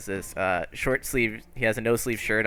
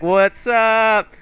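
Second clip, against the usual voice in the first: high, loud, stretched-out holler; rowdy group greeting; 12 semitones higher, 8 dB louder than usual. Acted. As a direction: holler it like a whole gang arriving. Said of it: "And they're like".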